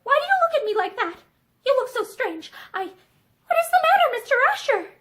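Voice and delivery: growing panic, voice catching; catch in her voice. A narrator's reading. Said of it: "with a little catch in her voice".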